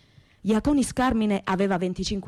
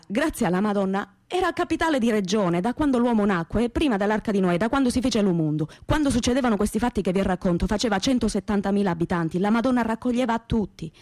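ordinary delivery

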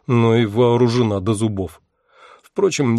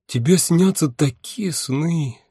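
envious drawl; 6 semitones higher, slower than usual. A narrator's reading. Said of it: "завистливо протянула она".

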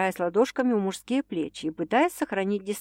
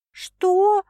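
high, louder stunned what; baffled; 12 semitones higher, 6 dB louder than usual. A narrator's reading. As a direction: ask in disbelief. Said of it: "обескураженно переспросила девушка".

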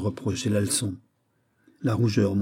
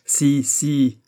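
higher, louder, insistent shout; worked-up cry; 5 semitones higher, 6 dB louder than usual. A narrator's reading. Prince working himself up against the chevalier, petulant cry. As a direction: insist like a wilful child. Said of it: "cria le prince en s'excitant lui-même".

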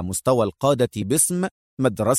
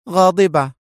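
curt, higher and louder woman; stern; 5 semitones higher, 5 dB louder than usual. A narrator's reading. Woman answering her smiling partner, stern and cross. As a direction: answer sternly in one word. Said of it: "أجابته في صرامة".

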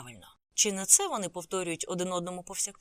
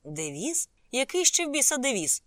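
puzzled echo bursting into loud, high protest; outraged question, rising sharply in pitch and volume; indignant; 7 semitones higher, 7 dB louder than usual. A narrator's reading. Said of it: "обурився Санджай".

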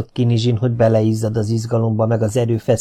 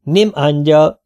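loud, raised-pitch foreigner talk; emphatic broken phrases, pitched up; broken speech; 5 semitones higher, 6 dB louder, slower than usual. A narrator's reading. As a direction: say it word by word, as to someone without the language. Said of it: "törte a szót Domi, mintha Kinizsi nem tudna magyarul".

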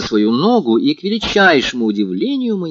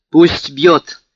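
loud, raised, defiant retort; 4 semitones higher, 7 dB louder than usual. A narrator's reading. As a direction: throw it back with blazing defiance. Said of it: "и глаза её засверкали". (с вызовом, громко)

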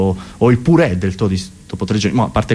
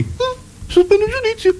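tiny falsetto squeal of a voice; absurdly high, strained doubter; squeaky mock sceptic. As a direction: squeeze it out very high, as a caricature. Mock sceptic answering a simple question.

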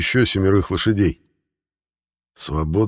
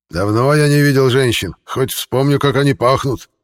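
higher, louder hoarse shout; 7 semitones higher, 4 dB louder than usual. A narrator's reading. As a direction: call down in a husky rasp. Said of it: "сиплый голос". (сиплый голос)

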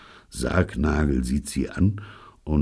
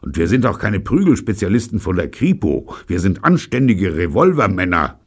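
louder, indignant complaint; grumpy, raised-voice protest; ill-tempered; 5 semitones higher, 7 dB louder than usual. A narrator's reading. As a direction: object with wounded pride. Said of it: "sagt er übellaunig".

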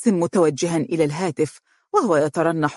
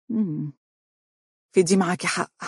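mildly resigned agreement. A narrator's reading. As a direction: concede reluctantly, without force. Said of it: "وقالت باستسلام".

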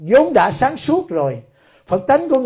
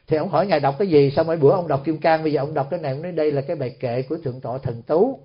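ordinary delivery

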